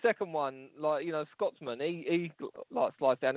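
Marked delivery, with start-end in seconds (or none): none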